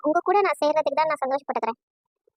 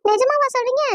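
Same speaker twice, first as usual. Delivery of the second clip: delighted surprise; 6 semitones higher than usual. Acted. Astonished at the good news.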